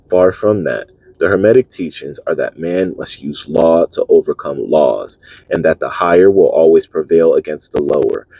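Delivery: solemn recitation of a teaching; formal reading aloud. Acted. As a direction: read the old text like scripture. Reading a Hermetic text aloud.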